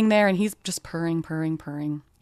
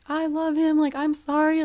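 much higher, louder tearful cry; retold crying out; 11 semitones higher, 5 dB louder than usual. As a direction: call it out to the mountain through tears. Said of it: "I'm crying, I'm screaming".

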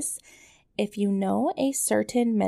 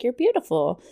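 cheery parent; 5 semitones higher, 5 dB louder than usual. Retold or imitated. Parent reassuring teen quote.

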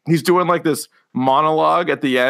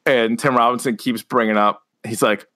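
ordinary talk, nothing marked

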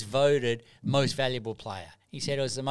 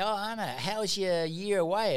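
chirpy friends; 7 semitones higher than usual. Retold or imitated. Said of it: "my friends would say".

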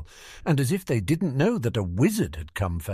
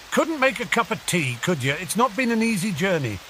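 higher, hearty rustic giant; big friendly character voice, pitched up; Hagrid; 9 semitones higher than usual. Acted. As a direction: grumble cheerily after a hard journey. Plays Hagrid's dialogue.

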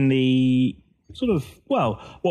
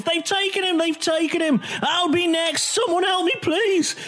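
much higher, frantic cries for help; panicked screaming; 13 semitones higher than usual. Acted. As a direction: scream it like a man fleeing kidnappers, pitch way up. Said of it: "he's yelling and shouting and screaming".

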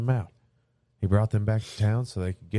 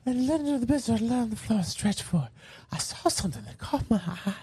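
put-on old lady voice; 12 semitones higher than usual. Continues the granny voice.

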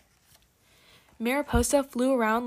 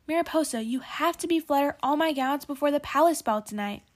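bossy sister; 5 semitones higher than usual. A narrator's reading. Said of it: "called Raina".

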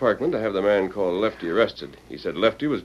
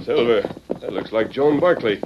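usual speaking voice

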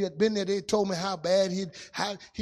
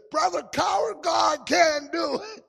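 high, loud cry from the pews; shouted encouragement; 10 semitones higher, 4 dB louder than usual. Retold or imitated. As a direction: call out to the preacher with a shout.

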